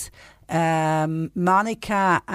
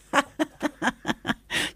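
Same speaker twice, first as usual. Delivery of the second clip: hearty laugh; 10 semitones higher than usual. Real, not acted. Laughs at sisters' nicknames.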